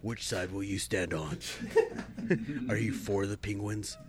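low, quiet trailer narrator; hushed dramatic trailer read; movie trailer voice. Pretend. Joining trailer voice parody.

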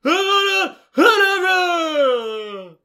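high, loud, slow drawn-out shout; Voldemort casting. Retold or imitated. Mocking Voldemort's limp-wristed curse, loud, slow shout. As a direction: cast it from the back of the throat. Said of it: "and he just goes".

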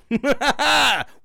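high, loud burst of laughter. Real, not acted. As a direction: burst out laughing at your own jibe. Laughs at his own insult.

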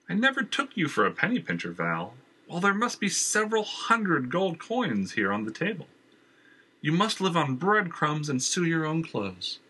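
a charming, pushy villain at the door; Jenner's voice. Acted. Jenner oozes false charm at Valerie's door. Jenner mocks her coins.

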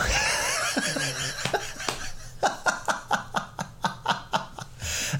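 long, soft, breathless laughing fit; real wheezy laugh. Real, not acted. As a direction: crack up quietly at your own story. Cracks up at his own story.

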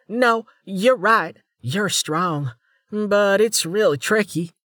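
bright-eyed junior officer voice; young woman voice. A narrator's reading. Female officer's voice.

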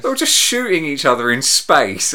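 ordinary talk, nothing marked

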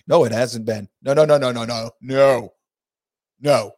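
loud, insistent denial; emphatic no. Real, not acted.

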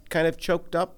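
ordinary delivery

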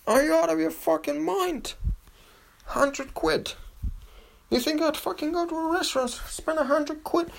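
squeaky falsetto mockery of penny-pinchers; mocking stingy people. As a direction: shriek it high and outraged like a scandalised miser. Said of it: "most people were like".

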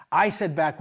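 usual speaking voice

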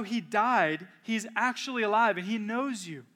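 measured pulpit delivery that slows on he came here; preaching build. Acted. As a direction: lean into it like a pastor revealing good news. Preaching to listeners.

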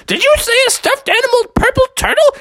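high, incredulous frog voice; astonished character question; acted amazement. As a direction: ask in excited disbelief. Froggy brakes, amazed at the gift.

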